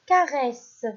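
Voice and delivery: "caresse" projected clearly, falling; teacher calling out "caresse"; loud dictation. Shown as a demonstration. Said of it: "Je répète".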